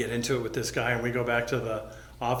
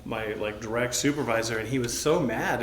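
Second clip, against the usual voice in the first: usual speaking voice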